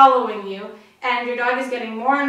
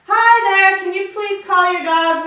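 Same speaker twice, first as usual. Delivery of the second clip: high, loud, friendly call; 7 semitones higher, 8 dB louder than usual. Shown as a demonstration. Practising asking a stranger to call their dog, calling out. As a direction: project it high to someone at a distance. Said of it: "when you raise your voice".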